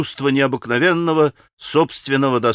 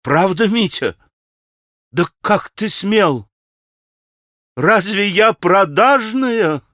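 high, slow, drawn-out outrage; hysterical cry; 6 semitones higher, slower than usual. A narrator's reading. Grushenka confronts Mitya over the money, hysterical. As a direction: wail each question higher, stretching it. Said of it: "истерически вскричала Грушенька".

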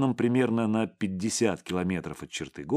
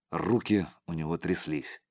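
ordinary delivery, nothing marked

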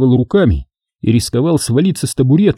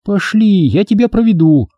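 put-on girl offering help; 9 semitones higher than usual. A narrator's reading. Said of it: "предложила Света".

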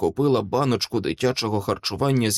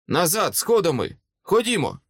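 higher-pitched urgent order; louder command; 7 semitones higher than usual. A narrator's reading. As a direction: call the retreat, raising the voice. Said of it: "але голосніше сказав". (наполегливий гучніший наказ)

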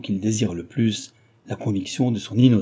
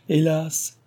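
high, brief sigh of regret in words; sorrowful cry; 7 semitones higher than usual. A narrator's reading. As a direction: lament with a raised pitch. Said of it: "s'écria Cécile".

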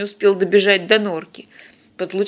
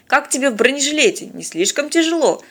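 worried, higher-pitched question; concerned; 6 semitones higher than usual. A narrator's reading. Stanislav checking on Polina in her bulletproof vest, concerned. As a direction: ask with mild, caring concern. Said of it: "обеспокоенно поинтересовался".

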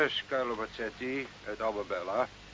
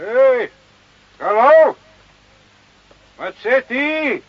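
loud high call, drawn out; 11 semitones higher, 10 dB louder, much slower than usual. Acted. Sergeant shouts after Carlo down the street, calling loud.

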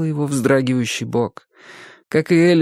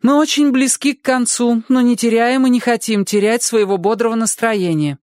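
high, louder reading; raising her voice; 12 semitones higher, 3 dB louder than usual. A narrator's reading. Girl reading louder to be heard, raised voice. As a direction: push the words over the others. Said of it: "возвысив голос".

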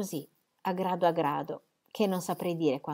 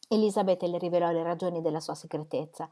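normal talking voice

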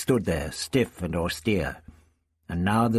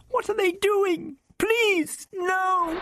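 high, terrified pleading shriek; terrified girl pleading for mercy; slower than usual. Acted. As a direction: beg in panic. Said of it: "Vicky shrieked".

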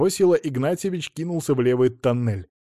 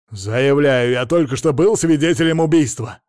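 loud, insistent, worked-up statement; indignant witness getting heated; heated declaration; 2 semitones higher, 7 dB louder than usual. A narrator's reading. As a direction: declare it hotly, building in pitch. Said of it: "разгорячился Мамонов".

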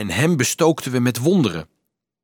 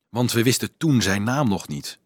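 ordinary delivery, nothing marked

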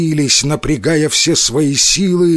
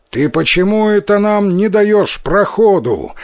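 high-pitched indignant call, mildly raised; shouted challenge; 6 semitones higher than usual. A narrator's reading. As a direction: demand to be let through. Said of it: "крикнул Тикуротаро".

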